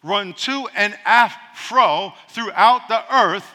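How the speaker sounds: very slow, weighty verse reading; solemn slowed reading of scripture. Shown as a demonstration. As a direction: draw the verse out word by word. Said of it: "it says".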